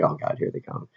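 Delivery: quiet, barely voiced mock dread; retold hushed dread. Reacting to the fire truck coming, hushed mock dread. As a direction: say it under the breath, as if dreading them. Second take of siren reaction.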